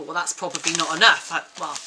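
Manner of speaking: usual speaking voice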